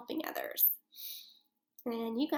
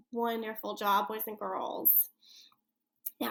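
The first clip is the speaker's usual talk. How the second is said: ordinary delivery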